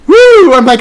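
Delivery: high, loud, delighted woo; thrilled woo at the singer's high note; excited woo. Real, not acted.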